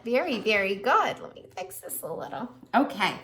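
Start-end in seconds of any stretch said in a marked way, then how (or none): 1.2-2.5 mumbled aside. Real, not acted. Aside while adjusting book.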